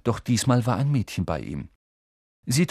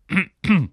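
loud, deliberate throat clearing; 9 semitones higher, 7 dB louder than usual. A narrator's reading. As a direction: clear your throat before performing. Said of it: "Er räusperte sich".

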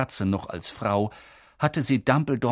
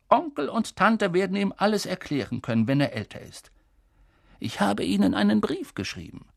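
higher, lilting, untroubled headmaster; lightly voiced Dumbledore, assured; 9 semitones higher than usual. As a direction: explain confidently, lightly in character. Narrator voices Dumbledore reassuring. (leicht gespielter, zuversichtlicher Schulleiter)

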